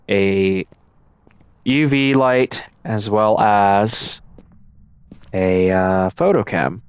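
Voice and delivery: ordinary talk, nothing marked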